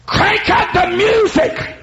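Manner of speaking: slow, high yelled devil's order; the devil howls it to all of hell; devil screaming. Retold or imitated. The devil orders hell's party, screaming high. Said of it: "the devil screamed".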